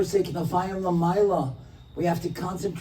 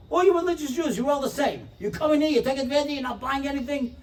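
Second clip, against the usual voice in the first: high, loud ranting waiter; angry rant; 10 semitones higher, 3 dB louder than usual. Retold or imitated. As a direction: keep railing at them.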